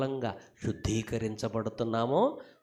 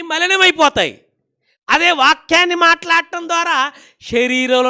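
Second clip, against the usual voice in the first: full-force preaching; 13 semitones higher, 15 dB louder than usual. Real, not acted. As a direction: belt it out to the congregation.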